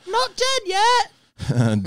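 high, very slow croak of a dying man; high drawn-out Monty Python not-dead-yet voice; mock dying man. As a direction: drag it out like you're at death's door. Echoing Monty Python plague quote.

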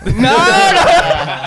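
high, drawn-out laughing no; mock denial. Friends joking about faked voices, high drawn-out mock denial. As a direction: protest in a squealed, stretched-out no.

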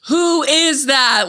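slow, high, drawn-out exasperated question; annoyed mom. Retold or imitated. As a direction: imitate a mother at the end of her patience. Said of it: "she gets so annoyed".

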